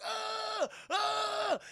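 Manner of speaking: high, drawn-out mock screams; mock screaming. Bo jabbed in the eye, mock screaming. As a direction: imitate someone screaming in pain. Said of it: "he's screaming".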